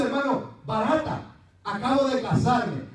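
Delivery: normal talking voice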